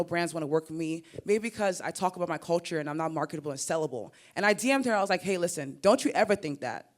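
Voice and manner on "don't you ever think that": ordinary talk, nothing marked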